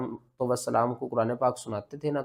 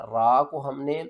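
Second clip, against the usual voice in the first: usual speaking voice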